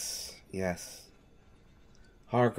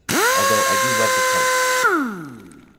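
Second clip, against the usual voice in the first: squeals it, much higher and louder, quick; high, loud squeal of admiration; gushing delight; 10 dB louder, faster than usual. Acted. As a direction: burst out in sudden delight. Servant gushes over suit colour.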